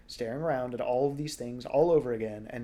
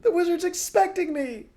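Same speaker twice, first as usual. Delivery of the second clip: very high, loud scream; 9 dB louder than usual. Acted. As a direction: scream to be let in. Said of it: "the person outside screamed".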